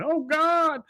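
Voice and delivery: high, loud cry of distress; mock scream. Dale chased down the street by bees, screaming. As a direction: scream it high like a man under attack. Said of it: "you just hear him saying".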